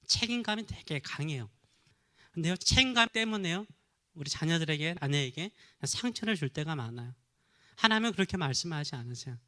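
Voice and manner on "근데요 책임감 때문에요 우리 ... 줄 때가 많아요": usual speaking voice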